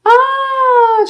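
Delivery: high, loud, drawn-out "ah"; delighted squeal. Real, not acted. Host spotting another shout-out, delighted squeal.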